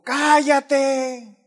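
high, loud, drawn-out command; hot-headed retort, imitated at full voice; imitated angry shout. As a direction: shout it in the policeman's face. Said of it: "Así se le puso, le dijo".

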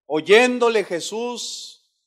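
ordinary talk

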